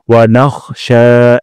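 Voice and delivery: "নাখশা" with a guttural খ, not কাফ, then a long শা; slow, scrape the kh and stretch the end; throaty kh, long sha. Shown as a demonstration. Teacher warning against saying নাক. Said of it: "খ উচ্চারণ করতে হবে".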